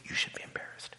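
hissed scolding whisper; sister's shaming whisper. Retold or imitated. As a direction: whisper it like a reproach.

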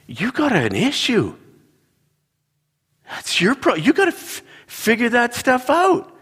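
high-pitched, sing-song mock exasperation; hammed-up high-voiced blame in a marital spat; exasperated scolding. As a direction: act out a squeaky, fed-up telling-off. Acted quarrel, blaming wife.

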